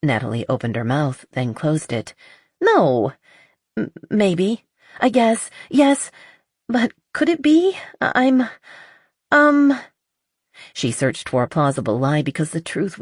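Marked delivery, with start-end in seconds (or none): 2.6-9.8 climbing higher and louder with each halting word; flustered stammer. Acted. Natalie flustered under her friend's questioning, pitch climbing. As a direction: nerves rising as she's cornered into admitting it. Natalie cornered about Ronan.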